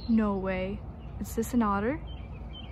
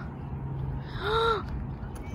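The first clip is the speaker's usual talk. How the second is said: sharp breathless gasp; startled gasp; 10 semitones higher, 8 dB quieter than usual. Real, not acted. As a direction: suck in air in sudden alarm. Startled by geese.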